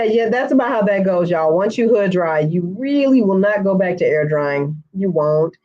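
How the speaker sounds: ordinary talk, nothing marked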